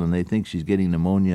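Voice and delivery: ordinary talk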